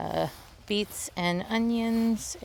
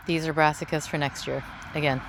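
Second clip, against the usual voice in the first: ordinary delivery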